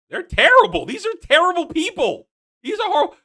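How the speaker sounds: high, outraged complaint; indignant rant about terrible people; exasperated rant. Real, not acted. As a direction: pitch it up in disbelief. Ranting about the Harfoots.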